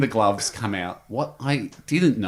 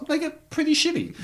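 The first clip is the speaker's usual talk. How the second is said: mock voice. Mock ant voice, sudden high.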